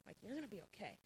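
soft, hushed words to herself; barely voiced, like a thought; inner comforting words. Comforting herself in her mind, hushed and gentle. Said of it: "saying in my, in my mind".

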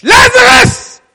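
huge high shout of "Lazarus!"; Jesus crying out to the dead man; loud cry. Acted. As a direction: cry out "Lazarus!" at full force. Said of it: "He cried out in a loud voice".

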